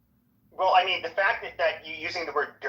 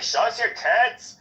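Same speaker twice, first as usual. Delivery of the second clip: slow, higher-pitched mimicry; fire captain's funny voice; 6 semitones higher, 4 dB louder, slower than usual. Guy repeats his catcall to the women, funny voice. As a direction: stretch each word, imitating a funny talker. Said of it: "so I'm doing his voice".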